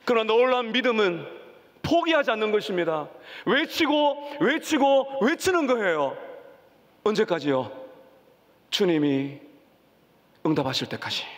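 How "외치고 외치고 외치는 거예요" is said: building cry. Acted. Preacher building on crying out.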